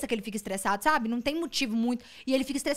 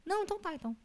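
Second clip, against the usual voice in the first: imitated meek husband; 8 semitones higher, 8 dB quieter, faster than usual. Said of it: "Aí ele".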